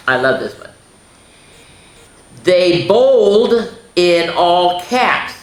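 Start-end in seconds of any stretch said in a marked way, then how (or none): 2.4-5.3 "bowled" stressed, slow and high; slow emphatic read. Shown as a demonstration. Teacher reading the pun slowly to the children. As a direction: draw out "bowled" and "caps". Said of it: "they all had caps on".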